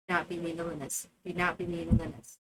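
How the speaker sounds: low, quick muttered reminder; muttered warning. Real, not acted. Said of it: "repeats quietly, lower".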